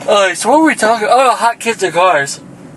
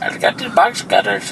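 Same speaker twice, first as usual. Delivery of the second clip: lightly put-on Indian accent, softer; half-hearted Indian accent, quieter; 4 semitones higher, 4 dB quieter than usual. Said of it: "we're doing an Indian accent".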